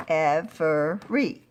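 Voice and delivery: "every" slowly, as three separate beats; split into syllables. Shown as a demonstration. Teacher recapping the syllable count, "every" slowly split in three. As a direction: break "every" into ev, er, ee. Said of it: "One, two, three".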